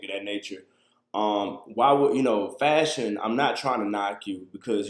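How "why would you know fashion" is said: ordinary delivery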